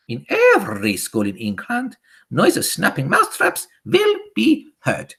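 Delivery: high, excited witch harangue; shrieking witch speech to the coven; Grand High Witch. A narrator's reading. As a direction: rant gleefully with rolled r's and an accent. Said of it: "shouted the Grand High Witch".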